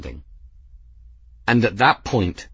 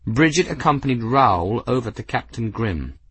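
ordinary delivery, nothing marked

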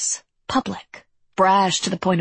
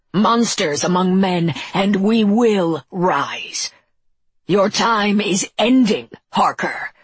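slow, raised roaring proclamation; monster's roar; 3 semitones higher, 4 dB louder, slower than usual. A narrator's reading. Malkai rallying monsters against Harker, slow roar. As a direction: roar it slowly as a rallying cry. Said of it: "roared the Malkai".

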